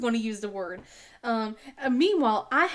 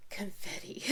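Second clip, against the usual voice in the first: whispered; 3 semitones lower, 11 dB quieter than usual. Real, not acted. Trailing aside about border.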